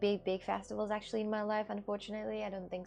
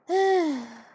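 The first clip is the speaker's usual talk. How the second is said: sigh; 7 semitones higher, 8 dB louder than usual. Real, not acted. Sad about plant.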